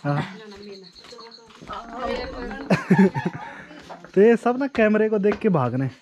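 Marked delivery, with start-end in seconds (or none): 1.7-3.5 real laugh. Real, not acted. Vlogger amused by family by the camera, chuckling. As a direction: chuckle under your breath.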